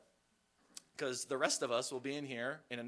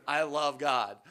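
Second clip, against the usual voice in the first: louder, firm and proud; a loud, heartfelt claim of faith; loud declaration; 2 semitones higher, 10 dB louder, slower than usual. Real, not acted. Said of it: "Sorry, there it is".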